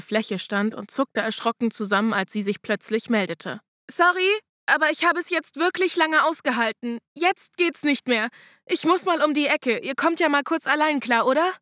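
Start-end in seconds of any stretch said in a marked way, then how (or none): 3.9-11.5 Lotte's voice. Acted.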